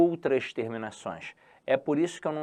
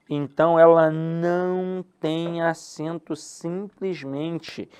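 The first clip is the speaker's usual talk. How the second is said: slow emphatic rule; 3 semitones higher, 3 dB louder, much slower than usual. Shown as a demonstration. Teacher stresses rule slowly.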